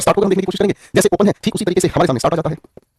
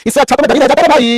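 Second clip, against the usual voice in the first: exasperated outburst; 8 semitones higher, 6 dB louder than usual. Retold or imitated. Acting an annoyed complaint.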